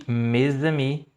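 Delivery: mes amis drawn out very slowly; slow demo. Shown as a demonstration. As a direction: sound out mes amis word by word. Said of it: "Isso na pronúncia". (pronúncia bem lenta de mes amis)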